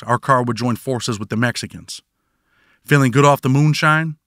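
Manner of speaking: ordinary delivery, nothing marked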